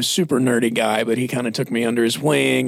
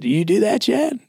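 higher Southern lilt, lifting on the name; pointed question in a put-on Southeastern accent; Southern pastor impression; 8 semitones higher than usual. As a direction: imitate a Southern mentor challenging his pupil. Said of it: "he talked like this".

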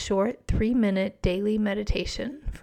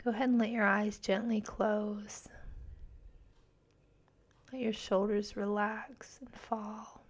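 guided meditation; 4 dB quieter than usual. Acted. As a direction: guide them gently into calm.

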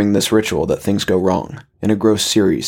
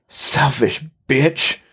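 slow, higher-pitched angry cry at ordinary volume; restrained scream; 5 semitones higher, much slower than usual. Acted. Husband standing over his fallen wife, restrained angry scream. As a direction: draw out the insult, raised in pitch but held back. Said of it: "he screamed".

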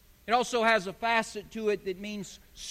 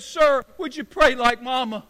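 raised, louder acted plea; insistent interruption; 4 semitones higher, 7 dB louder than usual. Dying man interrupting the praying chaplain, loudly insistent. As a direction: cut in and press the request.

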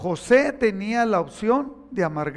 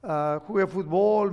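usual speaking voice

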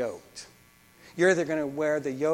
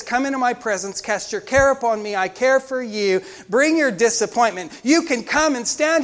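raised, high proclamation; urgent preaching; 8 semitones higher, 11 dB louder than usual. Acted. Preacher proclaiming God's invitation to the congregation, forcefully. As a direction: ring the invitation out loudly.

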